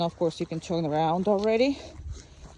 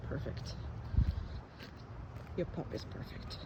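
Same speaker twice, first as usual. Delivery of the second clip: whisper; 4 semitones lower, 14 dB quieter than usual. Real, not acted. Whispering to the calm pup.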